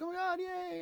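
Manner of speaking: very high, excited oh my God, yay; thrilled squeal. Retold or imitated. Strangers thrilled to see a blonde kid, high squeal. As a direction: squeal with delight. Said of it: "you know, like".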